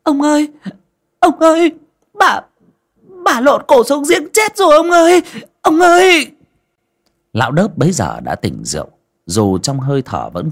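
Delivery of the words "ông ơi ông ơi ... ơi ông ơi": shrill, much higher and louder terrified cry; a maid screaming in terror at the well; panicked screaming. A narrator's reading. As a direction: scream for the master in horror. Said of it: "nó la lên oai oái".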